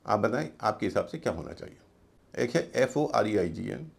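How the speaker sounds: ordinary talk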